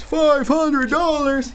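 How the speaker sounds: high, slow disbelieving echo of the price; astonished at the sum; surprised repeat. Real, not acted. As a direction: say the price back in shock.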